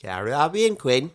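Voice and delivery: slow, strange backwards-talk voice; Twin Peaks little man. Retold or imitated. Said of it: "sounded like the Twin Peaks midget".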